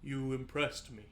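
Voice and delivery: lightly voiced deep celestial being; deep resounding voice. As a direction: speak with a hint of resonant, measured authority. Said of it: "A deep, resounding voice".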